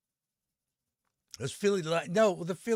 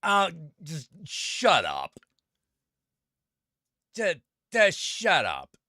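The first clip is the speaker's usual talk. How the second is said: fed up, higher and slower; exasperated retort; 4 semitones higher, 4 dB louder, slower than usual. Retold or imitated. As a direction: tell them to shut up, slowly and fed up. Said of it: "And I'm just like".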